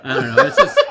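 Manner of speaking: squealing high with laughter; can't stop laughing; laughing hard. Real, not acted. Overcome with laughter.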